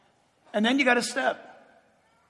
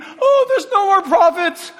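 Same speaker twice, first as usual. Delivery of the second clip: whining Elijah; 10 semitones higher, 10 dB louder than usual. Retold or imitated. Elijah whining to God that he's the only prophet left, high and loud. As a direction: moan like a self-pitying prophet. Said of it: "Remember Elijah's whining".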